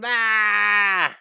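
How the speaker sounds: loud, high, drawn-out "aah"; mock scream. Teacher asking viewers for ratings, loud mock scream for one. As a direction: blast out a long pretend cry of horror.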